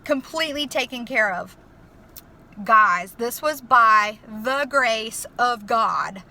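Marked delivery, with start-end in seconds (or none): 2.6-6.2 "by the grace of God" slow and loud, word by word; emphatic testimony. Real, not acted. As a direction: spell out "grace of God" with slow, forceful weight.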